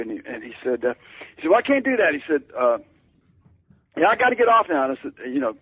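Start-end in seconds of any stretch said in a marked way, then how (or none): 1.5-2.1 high, loud, brusque demand; high-pitched, loud impersonation of a famous singer on the phone. Retold or imitated. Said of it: "shouting so loudly". 4.0-4.9 high, loud, brusque demand; high-pitched, loud impersonation of a famous singer on the phone. Retold or imitated. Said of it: "shouting so loudly".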